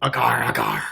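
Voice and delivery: pirate "arr" on request. Pretend. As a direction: growl it like a pirate. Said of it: "you say it like the pirate. Do it".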